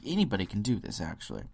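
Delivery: usual speaking voice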